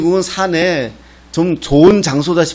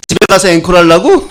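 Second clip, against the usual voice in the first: loud, higher-pitched teasing punchline; mock indignant shout; 4 semitones higher, 8 dB louder than usual. Pastor teases the audience at a village concert, mock indignant shout. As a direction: throw it out as a loud comic jab.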